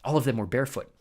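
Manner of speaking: ordinary delivery, nothing marked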